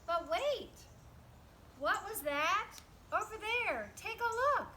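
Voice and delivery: usual speaking voice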